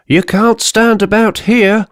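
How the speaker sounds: high shouted order; shouting inspector. Acted. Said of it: "shouted the inspector".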